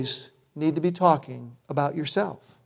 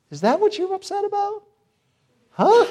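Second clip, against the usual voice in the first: mock baffled husband. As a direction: play the clueless husband in a squeaky, disbelieving voice. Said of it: "and I'm thinking".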